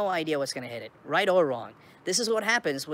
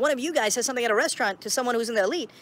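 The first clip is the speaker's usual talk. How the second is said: normal talking voice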